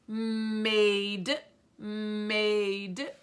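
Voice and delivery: "made" stretched with a long m; sounding out. Shown as a demonstration. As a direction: draw out "made" so each sound is heard. Sounding out a word for spelling.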